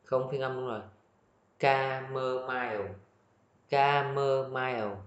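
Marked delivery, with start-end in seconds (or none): 1.6-5.0 Chamomile said slowly with a hard "k" start; slow model pronunciation. Shown as a demonstration. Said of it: "Chữ cờ ha".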